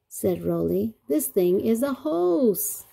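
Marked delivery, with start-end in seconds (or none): 1.1-2.8 slow, high, loud announcement; triumphant reveal. A narrator's reading. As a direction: draw out the big discovery. Story's big reveal read aloud.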